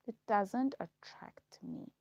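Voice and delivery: ordinary delivery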